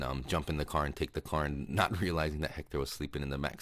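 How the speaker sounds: normal talking voice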